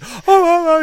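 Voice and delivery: mock winded groans; winded groaning. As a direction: gasp it out, breath knocked out. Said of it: "he can't breathe".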